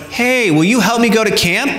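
put-on high, bright eager kid asking; confident child. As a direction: chirp it out to every shopper. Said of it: "she's going".

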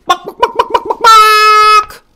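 high, loud clucks ending in a long squawk; chicken clucking. Acted. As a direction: cluck like a startled hen. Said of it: "It's a chicken".

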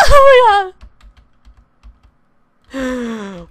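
shrieking very high oh my God; startled. Real, not acted. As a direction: jump at the lightning. Startled by lightning strike.

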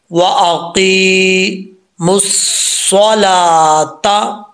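व अक़ीमुस्सलाता very slow, meem joined straight to the saad, waw and lam silent; correct recitation. Shown as a demonstration. Teacher demonstrating the correct reading to students, slow and chanted. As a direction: chant अक़ीमुस्सलाता slowly, skipping the silent letters. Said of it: "तो कैसे पढ़ेंगे?".

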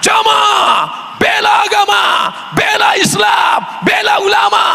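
high, booming preacher's cry; preacher roaring at the crowd, booming; thundering sermon shout. Shown as a demonstration. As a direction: thunder it out to the congregation. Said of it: "Keras, menggelegar".

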